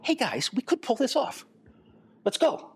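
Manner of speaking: high, bright pep-talk call to the team; rallying cheer. Retold or imitated. Team members rallying each other, high excited call. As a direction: pump the team up. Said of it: "look together and go".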